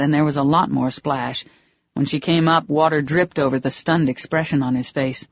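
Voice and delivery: ordinary delivery, nothing marked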